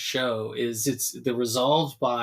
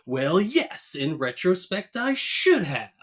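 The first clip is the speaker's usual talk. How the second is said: mock angry Homer; 6 semitones higher, slower than usual. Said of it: "And angry Homer goes".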